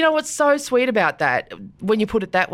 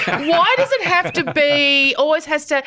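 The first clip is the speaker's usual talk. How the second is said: imitating whiny father, laughing; 7 semitones higher than usual. Dad moaning that dinner is never fancy enough, laughing mock whine. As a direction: stretch out the grumble while laughing.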